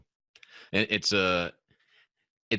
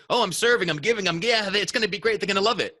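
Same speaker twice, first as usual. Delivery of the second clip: mock eager; 11 semitones higher, faster than usual. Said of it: "you could think".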